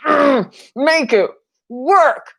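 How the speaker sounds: high, whiny pleading at the painting; frustrated painter. Retold or imitated. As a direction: beg the brush to make it work, hammed-up. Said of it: "you've got that brush and you're just like".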